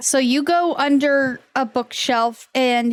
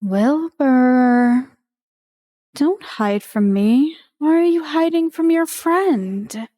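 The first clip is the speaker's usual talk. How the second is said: coaxing eerie voice. Acted. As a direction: lure him out like a false friend, lightly voiced. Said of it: "you hear the voice again".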